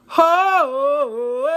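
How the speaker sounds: high, drawn-out howl of disbelief; mock-outraged "hooo", held long; long shocked "ho". Storyteller hearing date threaten to cancel, shocked whoop.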